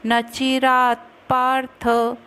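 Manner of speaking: चिरात पार्थ with half त run into प, slow; joined त-प. Shown as a demonstration. Teacher showing students the half त in चिरात पार्थ. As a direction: join चिरात straight into पार्थ. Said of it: "जोड़ के बोलेंगे".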